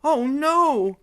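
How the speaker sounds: high, loud cry of alarm; dismayed exclamation. Acted. Mary Ann realizing who the invaders are, exclaiming in alarm. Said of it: "exclaimed Mary Ann".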